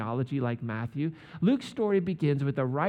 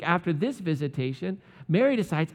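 ordinary talk

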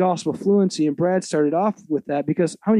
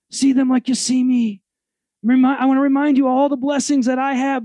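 raised, emphatic sermon voice; preaching; 4 semitones higher than usual. Acted. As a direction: preach it to the congregation with rising force. Preaching with building intensity.

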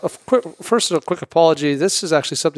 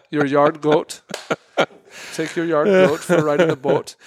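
hammed-up Minnesotan "yard goat" and "boat"; Minnesota accent. Host riffing on the goat line, mock Minnesota accent. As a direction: play up the Minnesota vowels for laughs. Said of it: "how do Minnesotans say".

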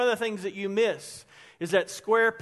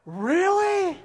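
much higher, laughing Really?; surprised girl; 10 semitones higher, 5 dB louder than usual. Retold or imitated. Girls at the lunch table react, high and laughing in surprise. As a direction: squeak it like an amazed schoolgirl.

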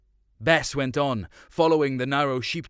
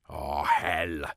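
gruff dad; 7 semitones lower than usual. A narrator's reading. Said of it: "Dad said".

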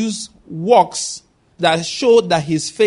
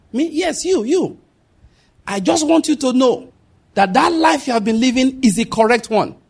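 high, raised voice of a stranger calling over; man calling across; 7 semitones higher than usual. Retold or imitated. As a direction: call her across the road, high and insistent. Man calls girl across road.

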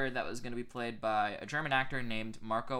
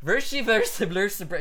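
loud, raised gibberish; Brando's fake-Spanish nonsense; 7 semitones higher, 8 dB louder than usual. Actor claims to speak a little Spanish, confident loud babble. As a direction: rattle off buzzing made-up words. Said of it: "just says nonsense".

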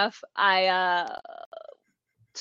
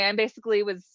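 ordinary talk, nothing marked

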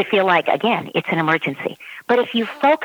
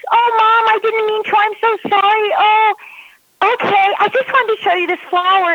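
put-on high, loud sorry little-kid voice; apologetic child; 14 semitones higher, 4 dB louder than usual. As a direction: play the contrite daughter. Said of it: "when you say it in that loving way".

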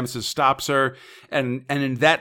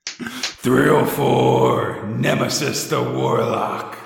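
slow, drawn-out announcer voice; show title voice; much slower than usual. Acted. Podcast host retrying the title from the top, slow announcer voice. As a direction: stretch each word like a grand title announcement. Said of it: "I lost the voice".

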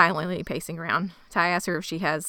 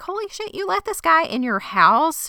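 high disbelief; 10 semitones higher than usual. Retold or imitated. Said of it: "Ty's like".

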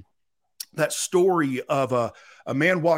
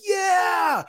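much higher, loud mock crowd cheer; 6 dB louder than usual. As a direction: whoop like an excited crowd. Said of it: "Everybody's like".

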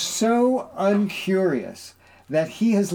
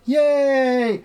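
mock cheer; 9 semitones higher, 4 dB louder than usual. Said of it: "people are like".

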